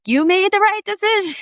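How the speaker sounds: high, loud and joyful, voicing the leaping dolphins. Dolphins leaping around the boat, cheering the couple in a high joyful voice. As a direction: cheer it out like happy dolphins approving.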